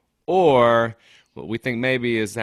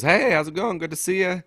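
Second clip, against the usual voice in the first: high-pitched mock-friendly hello; quoting her upbeat hello; imitated chirpy greeting; 10 semitones higher, 4 dB louder than usual. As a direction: greet like an old acquaintance, pitched way up. Retold greeting from an old friend.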